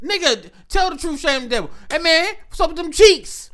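high-pitched comic man on the make; mock pleading suitor. Friend voicing the man's real request, high mock plea. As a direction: push it high, cutting through the euphemisms.

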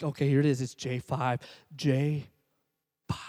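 low, measured button-picking; careful deliberation. Retold or imitated. Customer picks the button with great care, deliberate. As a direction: spell out the choice with deliberate pauses.

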